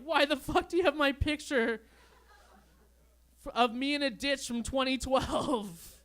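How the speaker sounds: high, loud mock outrage from friend; mock angry friend. Angry friend confronting him downtown, hammed-up outrage.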